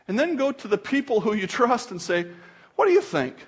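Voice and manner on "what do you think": high, lifted question; mock-earnest query, starting high; eager asking. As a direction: ask a friend for an opinion, pitched up. Acted question to trusted friends.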